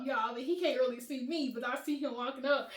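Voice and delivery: usual speaking voice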